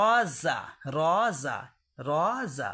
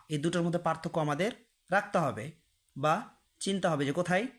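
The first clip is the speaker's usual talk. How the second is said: usual speaking voice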